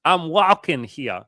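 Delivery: high, loud, drawn-out mock New York accent; exaggerated New York accent attempt; bad New York accent. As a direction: ham up a New Yorker, slow and loud. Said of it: "I can't do the accent, bro".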